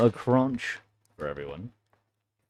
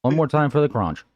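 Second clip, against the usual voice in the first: louder, announcing to the chat; loud announcement; 5 semitones higher, 8 dB louder than usual. Real, not acted. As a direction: call it out like a host. Announcing crunch to audience.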